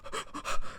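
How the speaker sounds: a sharp frightened gasp, acting out Heyman's fear; scared gasp. Heyman pinned against the door, gasping in fear. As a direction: gasp like a cornered man at the door.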